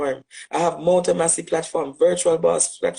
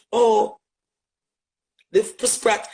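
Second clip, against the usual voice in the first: usual speaking voice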